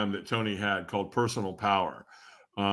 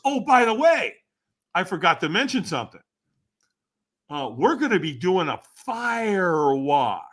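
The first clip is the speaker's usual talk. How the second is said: buddy's sly afterthought; 7 semitones higher, 4 dB louder than usual. Retold or imitated. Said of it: "he goes".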